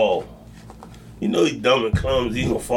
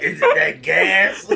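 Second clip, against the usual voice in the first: laughing; 6 semitones higher than usual. Real, not acted. Laughing game banter.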